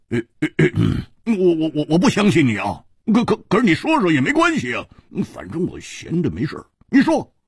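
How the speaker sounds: high, stuttering bluster, unable to hold back; flustered stammer. Acted. Hu Tiehua can't help begging the boy to talk, flustered stammer. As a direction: stumble and repeat words, then rush on. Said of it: "终于还是忍不住说".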